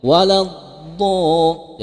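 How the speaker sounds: "Waladda-" drawn out slowly with a heavy dha; heavy emphasis. Shown as a demonstration. Teacher stressing the heavy letter, slow and emphatic. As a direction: stretch "Waladda-" and press the dha. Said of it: "jadi ada pene-, penekanan".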